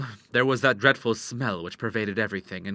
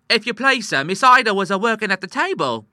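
loud, very high servant voice; flustered maid; 11 semitones higher, 9 dB louder than usual. A narrator's reading. Maid excusing herself to the master, high and flustered. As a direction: pitch it high as an apologetic maid.

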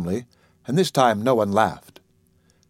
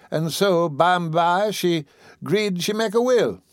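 put-on higher old woman's voice, scolding fondly; chiding old auntie; 6 semitones higher than usual. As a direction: tease him like the child you raised. Dicey tells the story.

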